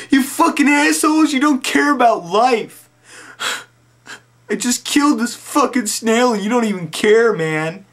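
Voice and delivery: very high, loud wailing rant; tearful rant. Retold or imitated. Crying narrator yelling at neighbors laughing at him. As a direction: scold them through mock tears.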